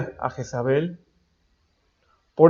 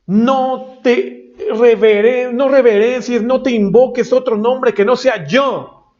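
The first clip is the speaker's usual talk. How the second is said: slow, higher, drawn-out divine command; God commanding; 6 semitones higher, slower than usual. Acted. Preacher voicing God telling believer not to fear, slow and raised. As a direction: spell out each word as God. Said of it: "dice Dios".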